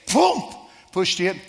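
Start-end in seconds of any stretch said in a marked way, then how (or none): 0.1-0.4 high, loud boom; shouted boom. Retold or imitated. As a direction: punch the boom like an explosion. Sound effect in story.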